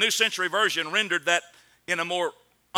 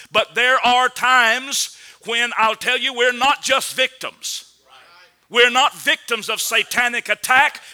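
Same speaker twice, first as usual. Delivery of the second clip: preaching; 5 semitones higher, 6 dB louder than usual. Acted. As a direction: drive each word home from the pulpit. Preacher confronting the congregation.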